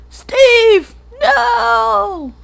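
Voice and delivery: high, loud, drawn-out wail; melodramatic, stretched-out shout; mock anguished cry. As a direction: cry out as if begging Steve to stop. Acting out a cry to Steve.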